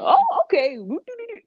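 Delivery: high, hammed-up delight; mock glee. She imagines her date's reaction to her day off, mock gleeful. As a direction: pitch it way up like a playful celebration.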